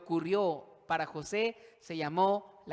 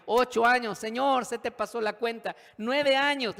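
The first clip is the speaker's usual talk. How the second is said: loud, high protest to God; mounting exasperation; 7 semitones higher, 10 dB louder than usual. Acted. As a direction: count the years in rising outrage. Mounting count of prison years.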